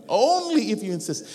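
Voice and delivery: hammed-up coy acceptance, high and loud; mock reluctance. Preacher mimics someone pretending to refuse a treat, hammed-up mock reluctance. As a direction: swoop up high on ONLY, playfully over the top.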